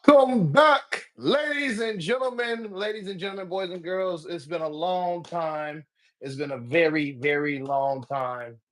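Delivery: big, high, loud showman welcome; announcer voice. Acted. Host welcoming listeners back to his podcast, booming announcer style. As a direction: open the show like a ringmaster hyping the crowd.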